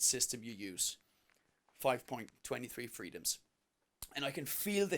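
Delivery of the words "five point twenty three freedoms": normal talking voice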